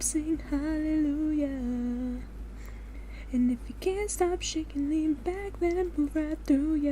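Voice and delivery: slow hammed-up gospel preacher call; mock preaching. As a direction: preach it to the sinners, drawn out. Mock sermon singing hallelujah.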